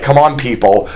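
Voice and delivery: lightly voiced Moses, fed up, last line jumping high; a hint of an exasperated leader pleading with his people; mild mock exasperation, rising to a high squeak at the end. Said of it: "And Moses is like".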